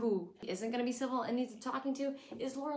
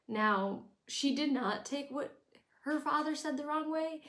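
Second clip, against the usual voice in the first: normal talking voice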